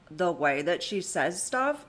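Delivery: ordinary talk, nothing marked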